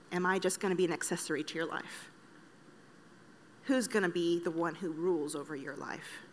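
calm, lightly voiced gentle voice of God; gentle but insistent. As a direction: ask gently, but keep pressing the question. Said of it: "very insistently but very gently".

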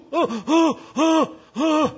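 high, winded grunts after a gut kick; pained grunts. Retold or imitated. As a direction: grunt high as if the wind is knocked out. Man kicked in the gut.

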